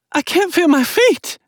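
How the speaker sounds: high and scared; high, frightened girl. Acted. Injured girl in hospital bed.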